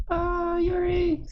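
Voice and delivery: the name cracks into a cry. Acted. Midas cries out to Yuri on the phone, sobbing.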